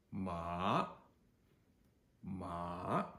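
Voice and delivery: MA drawn out from low to high; rising second tone. Shown as a demonstration. Teacher repeats second tone, MA rising low to high. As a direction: slide each MA up sharply at the end. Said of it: "starts low in pitch and then rises much higher".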